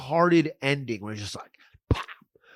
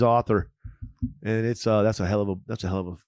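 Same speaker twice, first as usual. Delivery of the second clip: bad Japanese accent; 8 semitones lower, 4 dB quieter than usual. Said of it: "That was a fucking terrible Japanese accent I just did".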